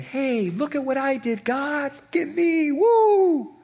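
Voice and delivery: high, excited look-at-me shout, ending in a whoop; showy bragging. Acted. Believer showing off to God for approval, excited bragging.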